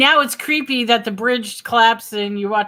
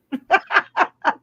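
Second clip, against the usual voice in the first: high bursting laugh; 8 semitones higher, 5 dB louder than usual. Real, not acted. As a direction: laugh out loud at the joke. Laughs after leprechaun line.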